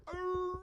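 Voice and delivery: high, loud yelping cry; echoing dog yelp. Acted. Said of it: "I'm gonna try to do it like this".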